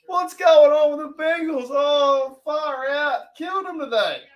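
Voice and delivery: very high, loud exclamation at the score; excited reaction. Real, not acted. Fan reacting to the Bengals game, high and excited. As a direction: marvel in a high-pitched voice.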